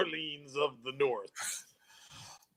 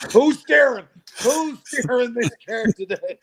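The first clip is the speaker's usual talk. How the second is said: mock call; 9 semitones higher, 6 dB louder than usual. Joking about pushing a wheelchair.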